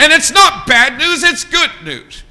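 high, loud pulpit proclamation; full-force preaching. Real, not acted. Preacher announcing God's message to the congregation, full-force preaching. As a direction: ring it out to the congregation.

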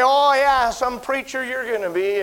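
imitated scornful jab; mocking taunt. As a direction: throw the taunt like a teasing kid. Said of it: "they'd say".

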